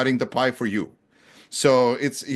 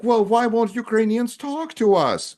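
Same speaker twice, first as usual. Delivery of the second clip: mock quote; 10 semitones higher, 3 dB louder than usual. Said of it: "They're like".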